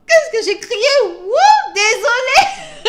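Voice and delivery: loud, high mock scream of shock; retold scream. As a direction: shriek it like she caught them. Screaming at the hotel scene.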